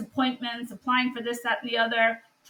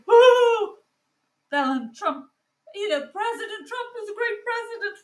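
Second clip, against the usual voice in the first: high, sing-song mock of a fawning senator; mocking Kennedy quote; 11 semitones higher, 3 dB louder than usual. Mocking a senator who praises Trump, high mock gush. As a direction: parody his gushing praise, pitched way up. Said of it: "for you to be standing there saying".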